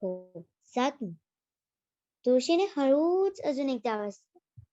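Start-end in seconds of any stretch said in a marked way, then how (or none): 0.0-1.1 low, slow and soft; a basil plant calmly sending the bees home; gentle basil plant. Acted. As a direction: send them off kindly and slowly.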